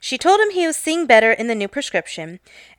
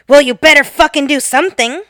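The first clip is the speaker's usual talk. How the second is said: louder mock blurt; blurted threat; 4 semitones higher, 7 dB louder than usual. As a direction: snap it out at the clerk. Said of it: "He blurts out".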